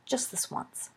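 soft, reluctant concession; hushed surrender; giving in. A narrator's reading. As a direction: concede it quietly, almost under your breath. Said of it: "Remus capitulated".

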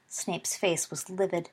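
plain reading voice

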